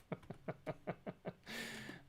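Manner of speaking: real breathy, soft laughter; soft wheezing giggle; laughing. Real, not acted. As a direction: laugh almost without voice. Amused by misheard phrase.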